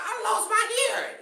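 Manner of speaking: high, loud wail through laughter; laughing, hammed-up cry of alarm; mock panicked yell. Mimicking person with clogged ears.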